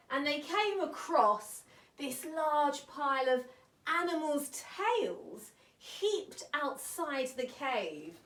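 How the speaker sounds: ordinary delivery, nothing marked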